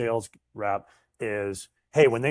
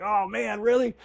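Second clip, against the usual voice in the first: mock dismay; 11 semitones higher than usual. Retold dismay at deal falling.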